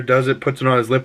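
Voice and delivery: ordinary talk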